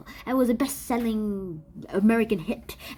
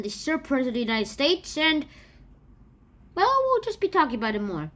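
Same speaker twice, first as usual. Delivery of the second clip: ordinary talk, nothing marked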